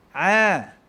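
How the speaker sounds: A pressed from the middle of the throat; throaty ain. Shown as a demonstration. Teacher demonstrates ain from the throat. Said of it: "keluar daripada tengah rengkung".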